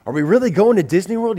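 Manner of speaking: put-on quick, eager child's chatter; excited kid. Boy hearing of the Disney World trip, excited. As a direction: ask it fast, lightly acting a thrilled kid.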